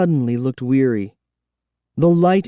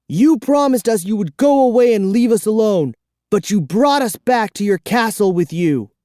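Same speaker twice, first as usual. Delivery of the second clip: put-on higher, louder complaining boy; indignant boy; 9 semitones higher, 3 dB louder than usual. A narrator's reading. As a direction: accuse him like a wronged kid. Boy protests to vampire.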